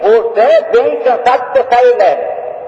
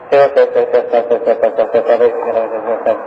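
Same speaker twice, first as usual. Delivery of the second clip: low, murmured chant of Senhor; mocking the empty repetitive pray-er; droning repeated prayer; 10 semitones lower than usual. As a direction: drone the word over and over.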